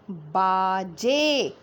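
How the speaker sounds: बाजे drawn out slowly, both vowels held long; sounding out. Shown as a demonstration. Teacher joining letter sounds for pupils, बाजे sounded out slowly. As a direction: stretch बाजे syllable by syllable. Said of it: "sound के साथ बोल बोल के join".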